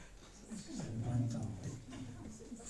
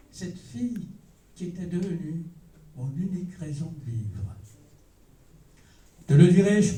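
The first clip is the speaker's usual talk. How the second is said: declamatory prose-poem reading, phrase by phrase with long pauses; measured, emphatic literary declamation; poetry recitation; 5 semitones higher than usual. Acted. Poet recites own prose poem.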